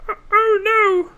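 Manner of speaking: very high, loud squeaky mock voice; cartoonishly high mock dismay. Shown as a demonstration. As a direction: squeal it in a silly falsetto. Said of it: "Make it a lot higher pitched".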